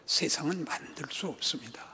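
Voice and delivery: sudden hushed whisper; preacher's dramatic whisper. Real, not acted. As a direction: drop to a breathy whisper for weight. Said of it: "preacher drops to a whisper".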